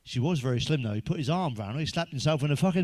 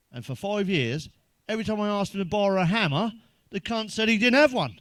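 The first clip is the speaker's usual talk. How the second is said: rising higher and louder; building punchline; 6 semitones higher, 4 dB louder than usual. Acted. Comic to the crowd, building louder to the payoff. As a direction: build the outrage toward the punchline.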